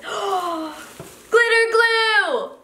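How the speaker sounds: gasp, then a high, drawn-out squeal; slow, high cry of delight after a gasp; delighted surprise. Real, not acted. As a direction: gasp and stretch the words out high, thrilled. Delighted find while unboxing.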